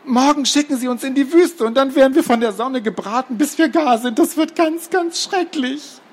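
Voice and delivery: put-on very high, fretful child voice; panicky girl Gerti. Gerti dreads being sent to the desert, panicky. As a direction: wail on in high-pitched dread.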